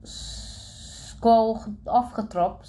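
acted stutter with a stretched hissing onset. A narrator's reading. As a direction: get stuck on the first sound, then push through. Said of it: "stotterde de jongen met de hippe bril".

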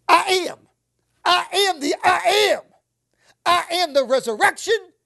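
loud, rising declaration of I am; proclaiming loudly. Acted. Jesus proclaiming to Martha, loud and high. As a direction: proclaim it with full force.

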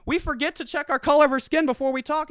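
high sarcastic voice voicing poor whites; high mocking rant. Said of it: "It's like".

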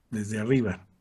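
ordinary delivery

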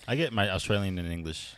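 ordinary delivery

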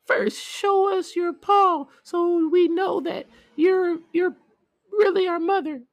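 very high, loud squeaky demand; kids crying out. Acted. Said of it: "The kids cried out".

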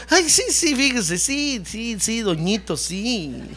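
high sing-song yeses, fading down; mock agreement. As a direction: humour him with exaggerated, fake agreement. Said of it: "¿Qué, qué tal yo le digo?". (asentimiento fingido y burlón, apagándose)

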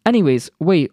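ordinary talk, nothing marked

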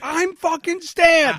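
high, loud, slow outraged shout; stabbed man shouting at the 911 operator, slow and loud; desperate yell. Retold or imitated. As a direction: scream it at the phone in disbelief. Yelling back at the operator.

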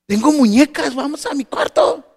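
put-on bright child pitch offering her dolls; little girl voice. Daughter inviting kids to her room, high child voice.

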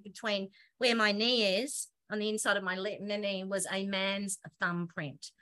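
ordinary talk

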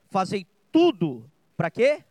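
loud, drawn-out build to a rising question; emphatic prompt. Real, not acted. Preacher prompting the congregation on a verse, emphatic call-out.

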